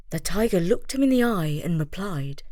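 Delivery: ordinary delivery, nothing marked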